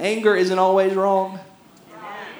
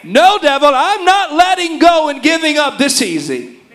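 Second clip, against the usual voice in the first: higher and louder defiant shout at the devil; mad outburst; 8 semitones higher, 6 dB louder than usual. Acted. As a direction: rebuke the devil with full force. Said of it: "get mad and say".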